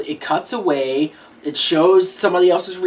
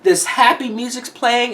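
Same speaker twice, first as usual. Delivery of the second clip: normal talking voice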